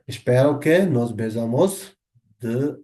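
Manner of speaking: very slow, careful Portuguese phrase; slow model phrase. Shown as a demonstration. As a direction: drag it out word by word for learners. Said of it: "বলতে পারেন আপনি".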